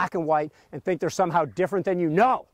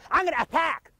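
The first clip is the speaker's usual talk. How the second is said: high-pitched, worked-up commander's cry; really excited; 9 semitones higher than usual. Acted. Antsy cavalry commander announcing an attack, really excited. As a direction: burst out like an overexcited cavalry officer. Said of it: "I picture him talking like this, like, really excited".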